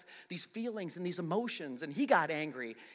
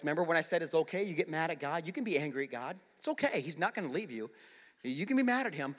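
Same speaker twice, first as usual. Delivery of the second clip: ordinary talk, nothing marked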